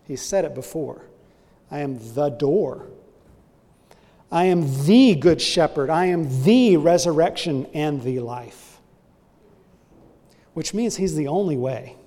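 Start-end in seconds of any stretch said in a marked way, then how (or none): 1.7-2.8 "the" held and set apart before "door"; stress on THE. Shown as a demonstration. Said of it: "have definite articles". 4.3-8.8 louder, each "the" punched as "thee"; stress on THE. Shown as a demonstration. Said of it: "have definite articles".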